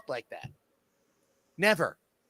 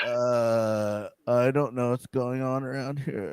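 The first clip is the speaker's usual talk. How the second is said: lower, mopey mumbled imitation; a hint of a sad, lost hero; mocking the actor; 6 semitones lower, slower than usual. Mocking the actor's Until Dawn delivery, lower and mopey. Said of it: "he does the same thing".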